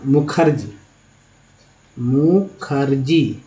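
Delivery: Mukherjee said slowly, syllable by syllable; slow spelled-out demo. Shown as a demonstration. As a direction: draw out Mukherjee for the learner. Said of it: "मान लीजिए लिखते हैं".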